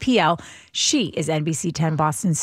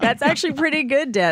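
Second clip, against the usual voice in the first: laughing; 6 semitones higher than usual. Real, not acted. Laughing praise, repeated.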